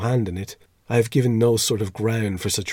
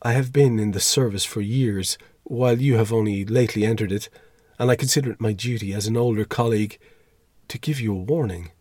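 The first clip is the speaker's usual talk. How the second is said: usual speaking voice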